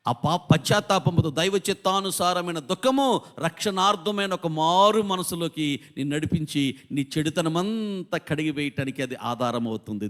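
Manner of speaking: usual speaking voice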